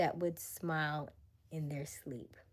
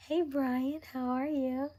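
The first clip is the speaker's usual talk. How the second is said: excited; 8 semitones higher, 4 dB louder, slower than usual. Real, not acted. Greeting viewer excitedly.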